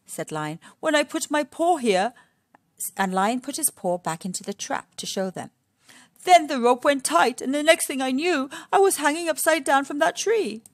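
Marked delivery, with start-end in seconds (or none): 0.8-2.1 higher, louder, quick innocent lion; a mildly innocent tale. Acted. Lion explaining to Missus Warthog, higher, louder, quick at the end. Lion tells his story. 6.2-10.6 higher, louder lion voice; a lightly dramatic retelling of the trap. Acted. Lion showing the warthogs the trap, higher and louder. Lion tells his story.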